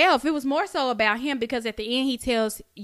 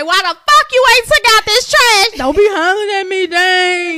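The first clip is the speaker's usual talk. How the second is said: high, loud hollering at a partner; hammed-up shouting; mock angry yell; 8 semitones higher, 11 dB louder than usual. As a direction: yell at him about the trash. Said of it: "But when you say".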